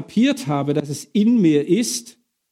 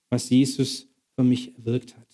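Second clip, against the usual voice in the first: ordinary talk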